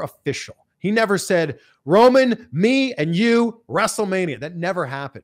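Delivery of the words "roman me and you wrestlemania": loud, high, stretched-out callout; big promo-style challenge; wrestler's challenge. Acted. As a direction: throw down a mock match challenge, punching each phrase.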